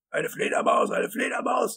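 loud, high-pitched raving; tobend. A narrator's reading. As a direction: rant in outraged disbelief, voice leaping high. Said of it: "tobte Wisconski". (hoch und laut tobend)

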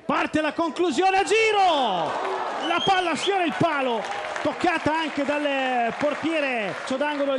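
high, loud shouted call; excited play-by-play. Real, not acted. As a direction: build up as the shot flies. Shot skims the post.

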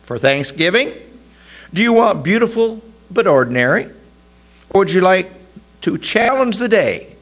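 high, fast cheerful saleswoman; shop clerk voice. A narrator's reading. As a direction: pitch it up like an eager florist.

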